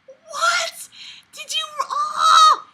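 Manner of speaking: a high, crying shout of disbelief; half-sobbing outrage; squealed What; shocked cry. Real, not acted. Reader shocked by story.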